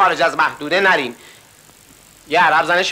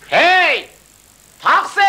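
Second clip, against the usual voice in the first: high shouted hail for a taxi; calling out; 10 semitones higher, slower than usual. Retold or imitated. Man from Ardabil hails a taxi, high shout. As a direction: yell out for a cab. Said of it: "فریاد کشید".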